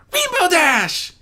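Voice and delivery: high, slow, happy filly gasp; a young filly gasping with joy at her idol; gasped happily. Acted. Filly gasps happily on seeing her idol. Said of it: "she gasped happily".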